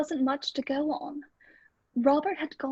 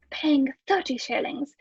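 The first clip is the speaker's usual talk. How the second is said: usual speaking voice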